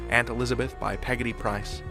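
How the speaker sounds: ordinary delivery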